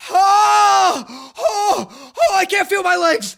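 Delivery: high, loud pained yelling; shouting while wounded. Retold or imitated. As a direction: cry out as if your legs just gave. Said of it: "all of a sudden he's like".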